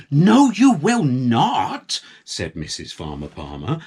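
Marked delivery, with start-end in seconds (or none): 0.1-2.0 loud, slow, stern refusal; firm no. A narrator's reading. Wife forbidding the tractor, sternly. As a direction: put your foot down.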